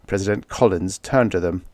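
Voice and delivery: usual speaking voice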